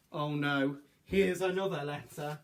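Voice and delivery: ordinary delivery, nothing marked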